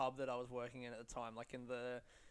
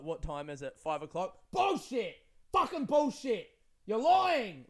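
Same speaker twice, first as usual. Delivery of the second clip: higher, louder, rising into a furious rant; paranoid yelling; 7 semitones higher, 9 dB louder than usual. Pretend. Paranoid person screaming that the time is a lie. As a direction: build from a calm question to accusing screams.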